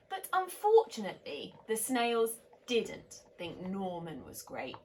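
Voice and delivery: lively picture-book reading; story narration. A narrator's reading. Reading a bedtime story to children, animated. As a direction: read it like a bedtime story to little ones.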